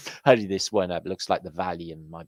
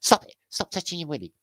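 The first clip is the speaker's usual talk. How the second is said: scolding; 12 semitones higher, 4 dB louder, faster than usual. Acted. Scolding shout.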